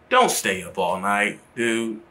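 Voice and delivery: normal talking voice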